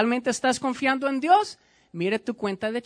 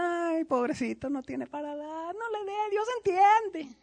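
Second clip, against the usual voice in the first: mock pitying preacher; 8 semitones higher than usual. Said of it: "De qué sirve que yo le esté diciendo".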